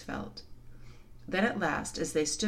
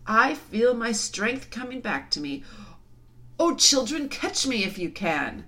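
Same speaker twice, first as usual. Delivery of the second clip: loud, rising joyful call; big, playful lion's challenge; exultant lion; 6 semitones higher, 6 dB louder than usual. A narrator's reading. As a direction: call out with returning strength. Revived lion invites a chase.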